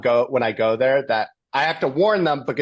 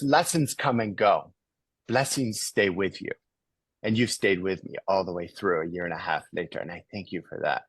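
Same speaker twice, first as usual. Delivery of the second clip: retold emotional reply; 6 semitones lower, 6 dB quieter than usual. John Lee thanking his benefactor, retold emotional reply, hushed. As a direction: let it sink softer toward the thanks. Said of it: "he's crying".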